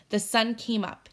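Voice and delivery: ordinary delivery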